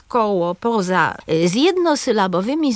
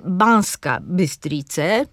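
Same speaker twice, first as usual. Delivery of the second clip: "Banska Bystrice" slowly, stressing BAN and BY; first-syllable stress on each word of "Banska Bystrice"; 2 semitones lower, much slower than usual. Shown as a demonstration. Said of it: "akcent pada na pierwszą sylabę wyrazu". („Banska Bystrice” z akcentem na BAN i BY)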